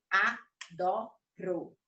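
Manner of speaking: each syllable of "Adoro" marked, drawn out very slowly. Shown as a demonstration. Puppet teacher drawing out every syllable of "Adoro" for students. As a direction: space out a-do-ro, very slowly. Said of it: "eu marquei as sílabas da palavra adoro".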